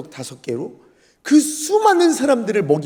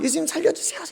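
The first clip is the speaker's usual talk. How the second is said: high-pitched, softer cry for help; mock pleading; 8 semitones higher, 5 dB quieter, faster than usual. Disciples shaking sleeping Jesus, begging for rescue. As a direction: imitate a panicked plea. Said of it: "살려달라고 막 예수님 흔든 적이".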